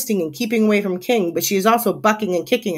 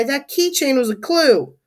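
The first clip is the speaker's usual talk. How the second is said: higher, upset protest; distressed cry; 6 semitones higher than usual. A narrator's reading. Narrator protests the lost clue, distressed cry. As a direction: plead over the loss. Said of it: "I cry".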